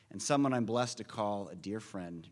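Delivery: usual speaking voice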